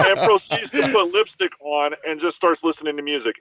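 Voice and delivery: ordinary delivery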